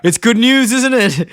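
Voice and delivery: a clueless husband hoping for the best; hopeful Homer. Retold or imitated. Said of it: "He looks at her and he goes".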